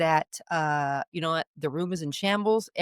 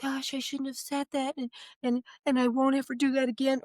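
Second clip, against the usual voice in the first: higher, softer, meek put-on voice; mock grovelling apology; 5 semitones higher, 3 dB quieter than usual. Parent apologizing too much to a teen, mock meek. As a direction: act out an over-sorry parent backing down. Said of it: "Not apologizing like".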